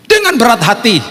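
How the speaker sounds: high-pitched, exaggerated reluctance; pained reluctance. Father giving in to buy the painting, pained and high. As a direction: strain it upward as if it costs dearly. Repeats phrase in pained, raised voice.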